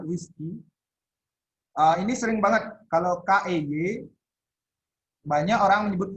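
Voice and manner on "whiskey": whiskey as WIS-kee; teacher models the right whiskey, WIS-kee. Shown as a demonstration. Whiskey diucapkan WIS-ki. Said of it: "Jangan whiskey dibilang ya".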